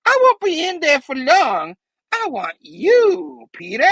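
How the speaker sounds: high, loud villain menace; hammed-up goblin-possessed threat; Norman. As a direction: sing it out high, theatrical and menacing. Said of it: "Norman's like".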